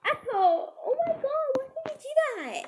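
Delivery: very high, loud staged outcry; mock outraged shriek. Storyteller framing the mean girl, mock outraged shriek. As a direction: cry out in put-on horror.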